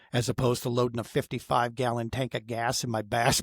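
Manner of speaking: ordinary talk, nothing marked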